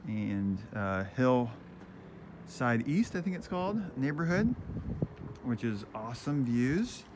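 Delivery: ordinary delivery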